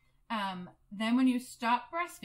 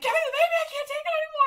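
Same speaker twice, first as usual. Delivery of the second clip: much higher, much louder pleading cry; desperate shout; 10 dB louder, much faster than usual. Retold or imitated. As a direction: beg at breaking point for the baby. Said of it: "I'm like".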